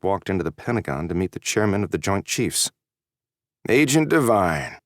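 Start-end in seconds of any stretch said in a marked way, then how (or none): 3.7-4.8 slow, nasal greeting. A narrator's reading. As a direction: pinch it through the nose, slowly. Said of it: "said the man in a nasally voice".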